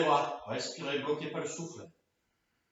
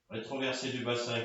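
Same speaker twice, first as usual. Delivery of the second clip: usual speaking voice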